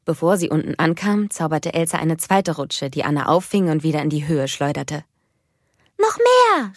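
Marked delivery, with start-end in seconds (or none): 6.0-6.7 put-on high, loud delighted child; little girl thrilled on the ice slide; excited call. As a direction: shout for more with glee. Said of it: "rief Anna".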